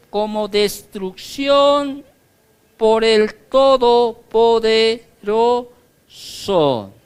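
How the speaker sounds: slow, loud, solemn Bible reading; scripture reading. Acted. Preacher reading the warning verse aloud to the church. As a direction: intone the warning slowly.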